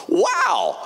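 a big delighted exclamation, almost shouted. Retold or imitated. Pastor reacts to groom's admission.

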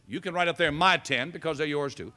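usual speaking voice

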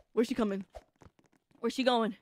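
usual speaking voice

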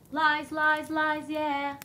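high, loud sneering chant of lies; mock singsong accusing. Real, not acted. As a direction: taunt the word lies like a jeer. Reacting to the stepmother's lies.

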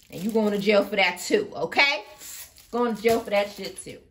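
loud furious warning; real rage, barking the jail warning; angry shout. Real, not acted. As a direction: shout the threat in real anger. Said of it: "Collective, let me calm down".

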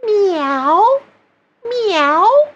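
high, gentle rising meow; smooth twang meow. Shown as a demonstration. Coach demonstrating twang, a gentle high meow. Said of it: "consistent and smooth".

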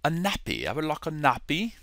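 quick, soft British accent. Shown as a demonstration. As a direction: ask softly in a put-on British voice. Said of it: "give you my British accent".

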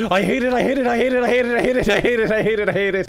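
high, loud repeated tantrum; frustrated rant. Real, not acted. Gamer fed up with his new job, ranting in frustration. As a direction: chant it like a tantrum, fading at the end. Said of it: "but I hate my new job".

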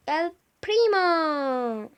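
long loud call; drawn-out call. Acted. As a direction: stretch the name out loud. Calling out to a character.

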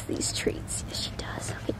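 soft unvoiced whisper; a whispered aside beside the dog. Real, not acted. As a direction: hush it while handing a treat. Whispering while feeding the dog.

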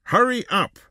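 high, cross call; cross shout. A narrator's reading. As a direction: shout crossly to hurry them. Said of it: "shouted the man crossly".

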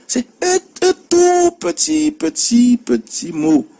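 high, slow drawn petit, petit, petit; tiny word stressed. Shown as a demonstration. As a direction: stretch the petit again and again for effect. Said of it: "Deux syllabes".

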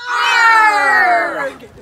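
loud pirate growl. Acted. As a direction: roar it like a pirate. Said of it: "I don't sound like a pirate".

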